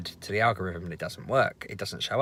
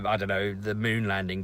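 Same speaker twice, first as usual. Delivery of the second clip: ordinary delivery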